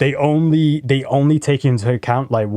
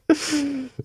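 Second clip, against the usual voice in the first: high laugh; 6 dB quieter than usual. Real, not acted. Laughs at keyboard joke.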